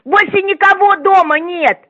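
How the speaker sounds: usual speaking voice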